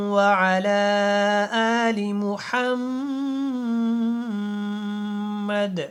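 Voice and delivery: slow, drawn-out devotional recitation. Real, not acted. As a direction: recite the opening prayer slowly and reverently. Opening dua before lesson. (آہستہ اور ادب سے تلاوت)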